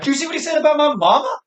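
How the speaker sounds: high, fast mock thug voice; imitated gangster. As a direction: parody a guy angry about his mama. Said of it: "some guy's like".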